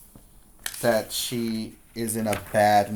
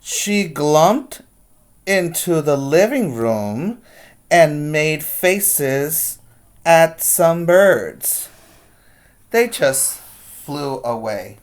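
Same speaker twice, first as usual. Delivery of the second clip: put-on lively read-aloud to kids; picture-book reading voice; story narration; 3 semitones higher, 8 dB louder, slower than usual. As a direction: read it like storytime, big and expressive.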